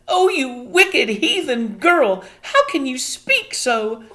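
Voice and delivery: high, loud, slow; appalled, dragging the words; horrified scolding. A narrator's reading. Homily horrified.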